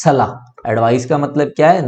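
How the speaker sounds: normal talking voice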